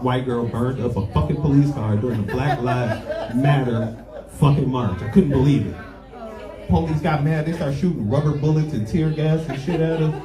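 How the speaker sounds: normal talking voice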